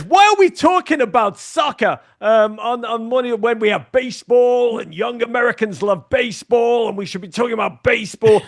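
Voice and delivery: high-pitched mock rant; Tom Brokaw. Anchor objects to soccer talk, acted-out high-pitched tirade. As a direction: imitate an indignant complaint, starting very high. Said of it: "he went off on me".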